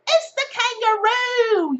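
slow, high, loud Aussie announcement; Australian accent. Pretend. Australian accent revealing the card to viewers. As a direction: stretch it out in a hammed-up Australian accent. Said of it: "I'm done with my Australian accent".

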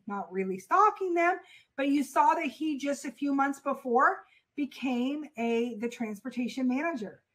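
ordinary talk

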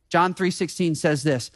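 ordinary delivery

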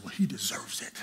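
whispered, stubborn reply; whispered defiance. Retold or imitated. Young Charles answering Carla's scolding in a whisper. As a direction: hiss back an excuse under your breath.

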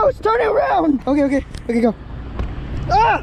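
high, loud squeal of panic; panicked. Real, not acted. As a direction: squeal as it moves. Maggot moving, panic.

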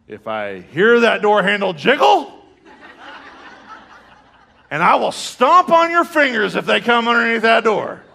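high, loud comic warning; mock fatherly threat. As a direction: threaten the kids in hammed-up outrage. Acts dad threatening his children.

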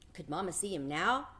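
usual speaking voice

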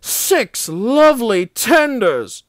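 high, drawn-out delight; chortling with pleasure, high and slow; gleeful chortle. A narrator's reading. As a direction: savour it slowly and gleefully, pitched well up. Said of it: "chortled Henry".

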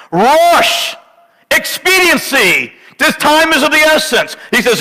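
high, fervent pulpit reading; full-force preaching. Acted. Pastor reading Numbers to congregation, urgent high preaching.

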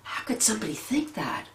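breathy disbelieving whisper; shocked whisper. Retold or imitated. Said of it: "you're just like".

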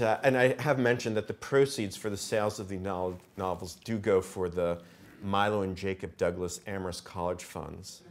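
normal talking voice

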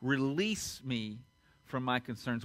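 slow, low, hushed plea to God; prayer. Retold or imitated. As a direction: pray softly, with pauses between phrases. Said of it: "I've started praying this".

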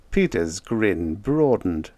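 ordinary delivery

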